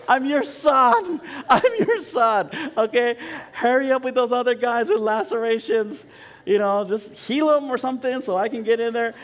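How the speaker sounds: loud, high, impatient mock prayer; comic pleading. Patient begs God to speed up the queue, loud comic pleading. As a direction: whine up to heaven, hammed-up and insistent.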